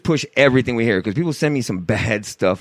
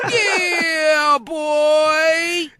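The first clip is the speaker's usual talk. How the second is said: alley cat; 13 semitones higher than usual. Acted. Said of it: "I sound like an alley cat".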